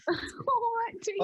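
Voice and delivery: high, squeaky mock-Cockney through laughter; high-pitched, laughing Cockney imitation; laughing mimicry. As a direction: giggle while copying a Cockney greeting. Mimics his Cockney greeting while laughing.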